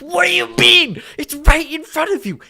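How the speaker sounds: high, loud outburst of disbelief; exasperated yell. Real, not acted. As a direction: yell it at someone ignoring the obvious. Exasperated outburst at doubting chatter.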